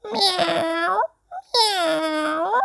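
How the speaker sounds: high squeaky cat meows. Acted. Reader voicing the farm cat, high meows. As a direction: meow like a little kitten.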